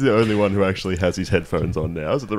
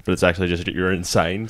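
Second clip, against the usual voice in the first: usual speaking voice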